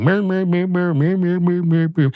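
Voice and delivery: high wordless "mer mer" drone like a muted trumpet; muffled teacher drone. Retold or imitated. The teacher's voice in Peanuts cartoons, droning "mer". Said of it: "the sound of a teacher going".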